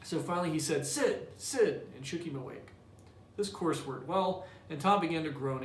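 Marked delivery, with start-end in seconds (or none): 0.9-1.9 put-on higher, urgent call of a boy's name; boy trying to rouse his brother, pitched-up call; calling to wake brother. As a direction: call him awake, pitched up. Tom calling to wake Sid.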